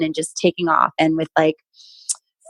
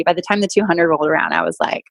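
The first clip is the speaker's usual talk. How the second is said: ordinary talk, nothing marked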